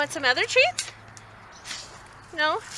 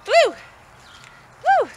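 calling out; 12 semitones higher, 8 dB louder than usual. Real, not acted. Calling dog across distance.

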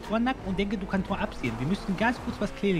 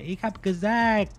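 slow menacing; 3 semitones higher, much slower than usual. Acted. Threatening game gangster.

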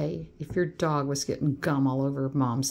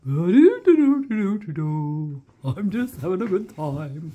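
voicing the pup; 5 dB louder than usual. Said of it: "but that pup".

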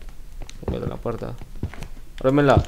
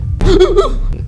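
very high, loud gasp; real scared intake of breath; startled gasp; 9 dB louder than usual. Real, not acted. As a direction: gasp hard at a sudden fright. Startled by the game.

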